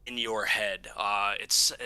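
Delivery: normal talking voice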